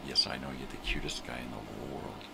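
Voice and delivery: ordinary delivery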